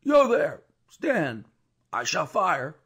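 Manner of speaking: high, loud threat to a rider; yelled warning before firing; guard's shouted challenge. A narrator's reading. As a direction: bark the order to stop.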